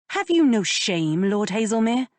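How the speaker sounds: lightly voiced lady, shocked and reproachful; indignant young lady. A narrator's reading. Georgiana scolds Benedict.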